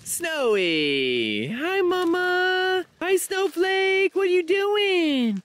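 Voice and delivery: very high, slow, drawn-out coo; high pet greeting. Real, not acted. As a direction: stretch each word out high to greet a pet. Greeting her yaks like pets.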